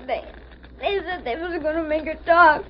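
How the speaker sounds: put-on sobbing boy, stumbling over words; crying between phrases; tearful account. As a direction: tell it haltingly through tears. Crying boy tells of kidnapping.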